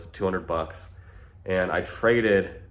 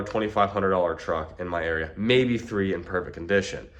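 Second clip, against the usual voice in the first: usual speaking voice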